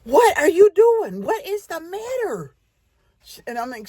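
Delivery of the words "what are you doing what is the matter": put-on a kid startled by her crying sister; alarmed. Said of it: "And I said".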